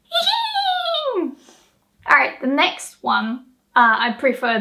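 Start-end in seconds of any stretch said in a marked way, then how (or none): 0.1-1.4 extremely high neighing hihin; really whinny like a horse; full horse whinny. Shown as a demonstration. Teacher shows the proper way to whinny. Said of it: "you gotta say like".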